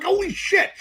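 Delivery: high, laughing holy shit; laughing disbelief. Retold or imitated. Learning his friend lived in L A for years, laughing high. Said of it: "I'm like".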